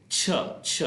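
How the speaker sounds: "छ" said as a sharp, unvoiced puff; plosive ch sound. Shown as a demonstration. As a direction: push out "छ" with a burst of air. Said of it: "इसकी आवाज़ जो है वो plosive है". ("छ" को झटके से, बिना आवाज़ के बोलें)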